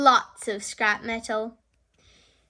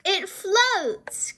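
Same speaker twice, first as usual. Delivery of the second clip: higher delighted exclamation; Grampy cries out; 7 semitones higher than usual. A narrator's reading. Grampy Rabbit seeing the boat float, higher delighted cry. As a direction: lift it up in happy surprise. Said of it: "cries Grampy Rabbit".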